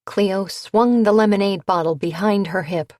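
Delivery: usual speaking voice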